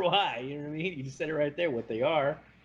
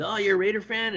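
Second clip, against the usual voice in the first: mock guy at the bar; 8 semitones higher than usual. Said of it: "this one guy was talking about Raider tattoos".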